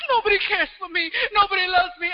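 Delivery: high whiny mock lament; imitating a sulking sad person, hammed-up; mock self-pity. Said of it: "Then they cry a little bit".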